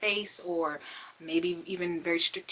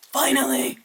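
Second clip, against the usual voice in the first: loud, higher exclamation; triumphant relief; 4 semitones higher, 6 dB louder than usual. Real, not acted. Unboxer gets a stubborn palette open, loud triumph. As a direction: burst out as it finally gives.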